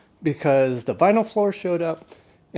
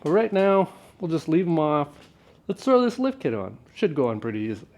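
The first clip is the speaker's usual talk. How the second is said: normal talking voice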